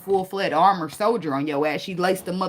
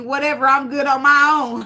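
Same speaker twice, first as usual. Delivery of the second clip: mock dismissive woman; 5 semitones higher, 6 dB louder than usual. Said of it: "she telling you".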